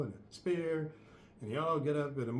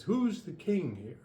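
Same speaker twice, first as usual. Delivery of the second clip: demanding invader; 7 semitones higher, 3 dB louder than usual. Acted.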